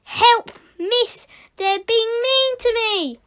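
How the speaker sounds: high, whining wail; loud, high tale-telling whine; crybaby wail. Acted. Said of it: "wailed Nick like a crybaby".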